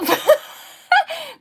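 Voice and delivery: high burst of shocked laughter; excited laugh. Real, not acted. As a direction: laugh out in disbelief. Overwhelmed by big donation.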